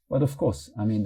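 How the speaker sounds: normal talking voice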